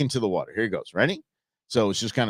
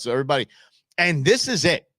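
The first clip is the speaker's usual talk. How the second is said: normal talking voice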